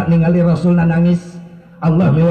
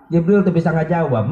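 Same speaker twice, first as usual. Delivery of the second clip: ordinary delivery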